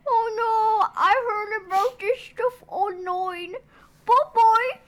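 high, quavery British granny voice; British grandma. Acted. Grandma fleeing the energy drink, quavery. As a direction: fret like an old British lady. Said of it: "She's British".

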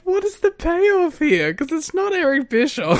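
high, slow disbelieving question; incredulous. Retold or imitated. Fan asking what the storyline payoff is, incredulous. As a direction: pitch it way up in disbelief. Retold puzzled reaction.

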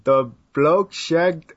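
very slow, halting fake British accent. Host takes a stab at the sentence, strained British accent. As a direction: attempt a British accent, word by word. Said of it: "do it in a British accent".

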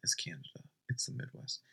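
real late-night whisper so the parents stay asleep. Real, not acted. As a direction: hush it, parents are asleep. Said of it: "if I'm being too loud and waking up my parents".

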